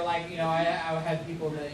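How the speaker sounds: ordinary talk, nothing marked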